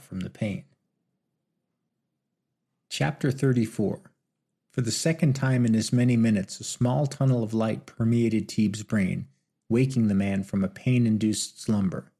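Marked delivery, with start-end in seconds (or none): none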